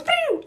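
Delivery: very high darting zing; cat dashing noise. Retold or imitated. Showing the cat running, high imitated whoosh.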